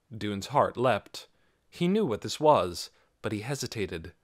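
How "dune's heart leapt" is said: plain reading voice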